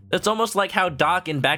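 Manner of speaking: usual speaking voice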